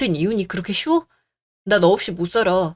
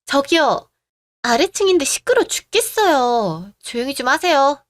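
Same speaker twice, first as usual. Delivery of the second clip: raised, higher voice, drawn out in annoyance; irritated complaint; 5 semitones higher than usual. Acted. Downstairs neighbour complains about noise.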